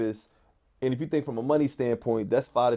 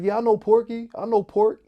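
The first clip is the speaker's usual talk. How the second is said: high, louder retold voice of a Philly block kid; local kid's reply; 11 semitones higher, 6 dB louder than usual. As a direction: pitch it up and loud like the kid from the block recognizing the name. Local kid vouching for Porky.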